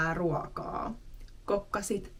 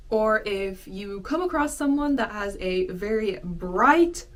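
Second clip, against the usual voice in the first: ordinary talk, nothing marked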